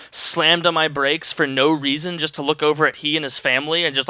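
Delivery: usual speaking voice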